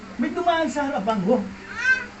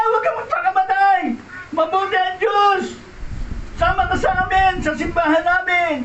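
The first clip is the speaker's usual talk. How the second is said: fervent plea; 7 semitones higher than usual. Retold or imitated. Friend begging Rodel to live and come to church. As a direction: urge him earnestly.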